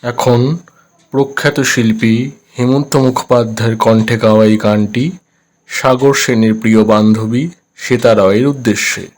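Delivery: transistor radio. Acted. Radio switched on, announcer speaks.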